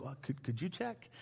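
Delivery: very soft, tentative aside; hushed request. Retold or imitated. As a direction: ask it under your breath, hesitantly. Acted discreet request to coworker.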